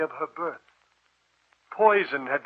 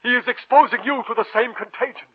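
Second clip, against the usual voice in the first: urgent warning; 6 semitones higher, 8 dB louder than usual. Acted. Professor urgently warns.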